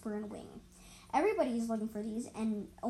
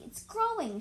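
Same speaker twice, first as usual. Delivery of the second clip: much higher, eager burst; excited; 10 semitones higher, slower than usual. Acted. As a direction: squeal it out with delight. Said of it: "she leaped".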